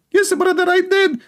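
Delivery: very high, silly sing-song voice; high-pitched mock confession; squeaky mock reply. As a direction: answer like a meek, eager child.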